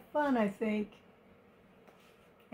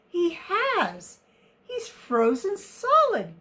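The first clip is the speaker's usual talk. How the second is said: higher-pitched storybook astonishment; gasped alarm; 8 semitones higher, 6 dB louder, slower than usual. A narrator's reading. An elephant seeing frozen Elmer, gasped alarm. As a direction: exclaim it high, in mild shock. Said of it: "gasped one of the elephants".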